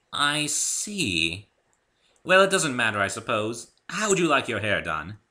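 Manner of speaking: a gracious unicorn hairdresser; refined Rarity. A narrator's reading. Rarity offering Dave a haircut, high and refined.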